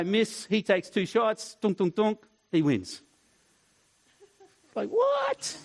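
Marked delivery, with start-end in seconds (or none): 4.7-5.6 high-pitched incredulous what; baffled. Retold or imitated. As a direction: squeak out your disbelief. Baffled by cheating opponent.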